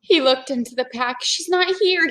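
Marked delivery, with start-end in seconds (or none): 1.2-2.1 put-on higher worried boy voice; Jack's worried voice. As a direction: sound like Jack finding the pack empty. Jack voiced in read-aloud.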